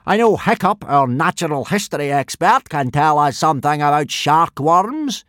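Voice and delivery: big blustering Viking teacher; booming Gobber. A narrator's reading. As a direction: boom the orders at the boys. Gobber voiced in story.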